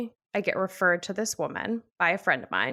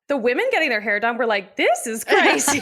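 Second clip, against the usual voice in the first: loud, high, excited salon chatter; excited outburst; 5 semitones higher, 7 dB louder than usual. Retold or imitated. Women in the salon erupting in loud disbelief. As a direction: blurt it out over the din.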